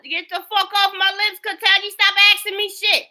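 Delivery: angry yell. Real, not acted. Yelling at the woman.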